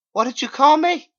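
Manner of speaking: very high, loud startled voice; shrill shocked question. Acted. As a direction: jump at the name. Narrator startled.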